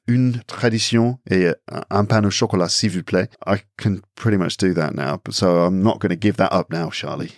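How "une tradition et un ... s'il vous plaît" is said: French accent, ordering at the bakery; practised French order with French R; confident French. Shown as a demonstration. Showing what they've mastered in French, confident French accent. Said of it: "one of the things I can now confidently say".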